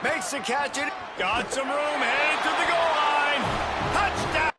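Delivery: rising excited call to a shout; touchdown call. Real, not acted. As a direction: build from quick to big, then yell it.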